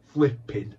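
"flipping" with a long, held, punched P; hang on the P. Retold or imitated. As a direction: lean hard on the P in "flipping". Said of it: "you hang on the P's".

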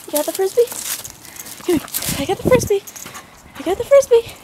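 squeaky doggy-talk voice. Real, not acted. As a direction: chirp it like talking to a puppy. Baby voice to dog.

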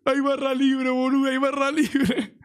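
high, stretched imitation of a drunk friend yelling; mock excited yell. Friend with two drinks celebrating free bar, mock excited yell. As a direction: mimic an overjoyed friend announcing it to everyone.

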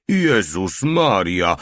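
slow, drawn-out alarmed yell; a moderate narrated yell of alarm. A narrator's reading. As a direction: cry it out as you dive for cover. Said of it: "wrzasnął".

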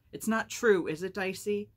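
loud, high-pitched angry challenge; angry demand. A narrator's reading. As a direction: demand it at full force, like an upset child. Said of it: "with an angry demand".

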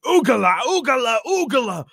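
high wild battle scream, fading. Acted. Covered in blood before the staring crowds, high scream. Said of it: "just screaming".

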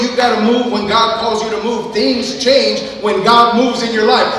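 loud, high closing climax; full-force preaching. Acted. Pastor proclaims demons flee God's move, loud preaching. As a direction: drive it home to the church.

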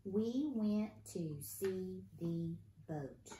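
slow, word-by-word reading aloud; drawn-out beginner-reader pace; slow careful reading. Shown as a demonstration. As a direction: read it slowly, each word separate. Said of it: "Let's read it".

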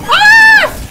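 sudden high scream; startled scream. Real, not acted. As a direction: shriek in fright. Startled in horror game.

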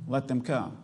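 hushed, gentle repeat of "let them come.". Acted. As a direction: let "let them come." settle quietly after a pause. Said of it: "Whosoever will".

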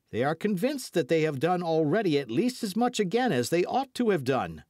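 high-pitched, insistent hot-headed retort; quarrelsome. A narrator's reading. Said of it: "quarrelsome spirit began to speak more loudly".

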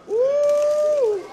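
excited squeal. Real, not acted. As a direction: squeal with delight. Reacting to gum.

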